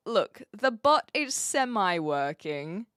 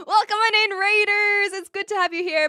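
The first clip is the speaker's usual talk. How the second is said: high, loud welcoming call; excited welcome; 10 semitones higher, 5 dB louder than usual. Real, not acted. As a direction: greet the arriving crowd with big energy. Streamer welcomes incoming raiders.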